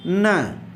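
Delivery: "न" as a lone "nuh"; 'न' letter sound. Shown as a demonstration. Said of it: "यन का उच्चारण क्या होता है". ('न' की ध्वनि का उच्चारण)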